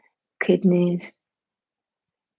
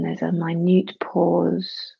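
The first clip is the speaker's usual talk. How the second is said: ordinary talk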